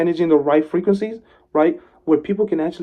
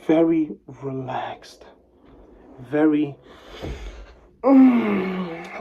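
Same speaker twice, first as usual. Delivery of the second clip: slow, breathy relaxed hush; relaxed whisper; much slower than usual. Shown as a demonstration. Showing viewers his calm relaxed tone in a whisper. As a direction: ease it out slowly in a whisper. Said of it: "I always speak like this".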